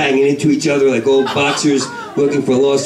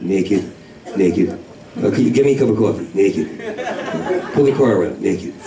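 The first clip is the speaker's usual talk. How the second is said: deadpan naked; 4 semitones lower, 3 dB quieter than usual. Acted. Deadpan low refrain naked.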